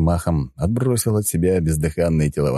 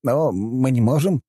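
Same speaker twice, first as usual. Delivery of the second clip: helpless protest; 8 semitones higher, slower than usual. Acted. Vampire pleads with yelling elder.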